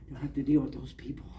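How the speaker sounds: quick whispered aside; relieved whisper. Retold or imitated.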